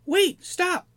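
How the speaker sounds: very high, loud goblin command; commanding shout. Acted. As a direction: stop everyone cold with a squeaky order. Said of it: "in his most commanding voice".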